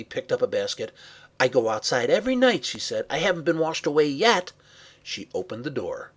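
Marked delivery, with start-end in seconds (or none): 1.4-2.6 quick, loud, higher-pitched retort; defiant retort. Acted. Girl argues back to sister. 3.1-4.5 fast, loud, higher-pitched comeback; defiant retort. Acted. Girl argues back to sister.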